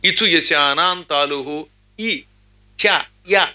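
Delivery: slow, chanted grammar rule; sutra recitation. Shown as a demonstration. Teacher reciting a Sanskrit grammar rule, chanted slowly. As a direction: intone the Panini sutra slowly. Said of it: "తాళవ్యము అన్నారు".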